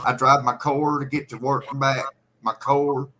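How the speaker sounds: car pronounced "core" inside an everyday sentence; regional car. Shown as a demonstration. Demonstrating the accent in use, "core" for car. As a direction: round car into "core", KOH-er. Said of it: "We say core".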